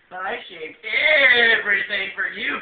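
mock pleading. Mock pleading with the other man.

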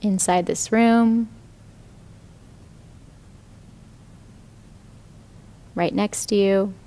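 calm, even meditation voice with long pauses; guided meditation. Real, not acted. Yoga teacher guiding students lying down into rest, meditative.

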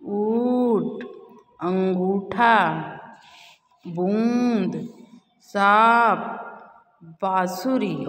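each word stretched very slowly with a lingering nasal vowel; deliberate, very slow teaching read; slow, held nasal vowels. Shown as a demonstration. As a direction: drag out every chandrabindu word for the class. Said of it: "उसको हम थोड़ा रुक के पढ़ते हैं".

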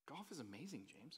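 softly devoted golfer voice; reverent golf lover. Retold or imitated. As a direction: speak of golf like something sacred. Friend praising golf.

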